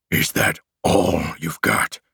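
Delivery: slow, drawn-out monster taunt; monster growl. A narrator's reading. Monster looming over the hero, slow taunting growl. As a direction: growl it slowly, dragging out the words.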